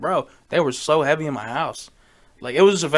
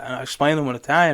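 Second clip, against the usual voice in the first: ordinary delivery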